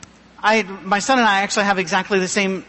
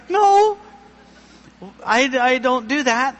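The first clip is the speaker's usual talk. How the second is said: pitched way up, playing out-of-place; awkward denial; 3 semitones higher than usual. Answering preachers' book and podcast questions.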